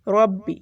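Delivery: ربی with a thick r and doubled b; heavy r and stressed b. Shown as a demonstration. Teacher demonstrating ربی with firm doubled b. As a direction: hit the doubled ب in ربی firmly. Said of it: "تھوڑی سختی سے پڑھنا ہے".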